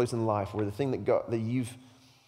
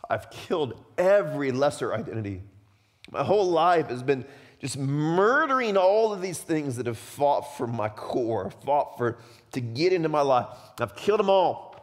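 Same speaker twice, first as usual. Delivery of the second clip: impassioned anguish; 2 semitones higher, 4 dB louder than usual. Acted. Imprisoned John looking back on his life, impassioned. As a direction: pour out a desperate protest, rising to the end. Voicing John's anguish in prison.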